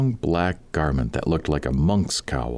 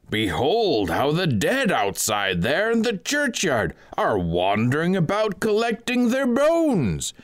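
high, slow, ringing cry from the pulpit; high, slow doomsday proclamation to the sinners; piercing preacher's cry; 8 semitones higher, slower than usual. A narrator's reading. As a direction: call out like a doom-preaching monk, drawn out and high. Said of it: "He cried with a loud and piercing voice".

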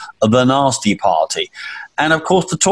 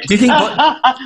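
laughing; 11 semitones higher than usual. Real, not acted. Laughing through the jibe.

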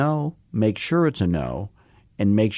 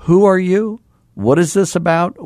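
suspicious callee; 9 semitones higher, 5 dB louder than usual. Retold or imitated. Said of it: "then they say".

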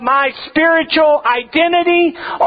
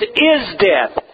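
"is" hit hard, then falling "death"; stress on is; 2 semitones lower than usual. Shown as a demonstration. Preacher to congregation, correcting a misreading, stress on "is". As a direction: land firmly on "is" in "is death". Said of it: "It says".

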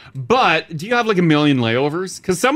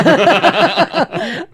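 mocking stretched word; 11 semitones higher, much slower than usual. Mocking the costly trip.

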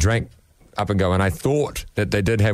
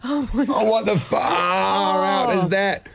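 slow, laughing shocked yell; real shock while laughing; shocked laughing shout; 9 semitones higher, much slower than usual. Real, not acted. Mouth burning from hot sauce.

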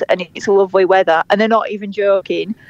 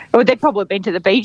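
normal talking voice

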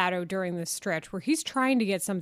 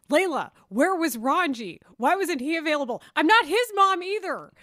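higher, louder exasperated quoting; raised-voice mock indignation; mock exasperation; 6 semitones higher, 5 dB louder than usual. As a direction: play up the pestering questions and fed-up comeback. Voices texter, retorts exasperated.

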